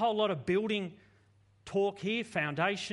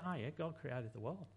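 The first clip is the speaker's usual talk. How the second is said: soft, low, unimpressed; bored shrug; 4 semitones lower, 7 dB quieter than usual. Acted. A churchgoer shrugging off creation, softer and lower. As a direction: say it like it means nothing. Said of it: "not just go".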